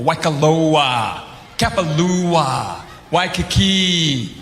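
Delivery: slow, savoring Hawaiian place names; local Hawaiian cadence. Shown as a demonstration. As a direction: roll each Hawaiian name out slowly like a local. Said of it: "start speaking like a local".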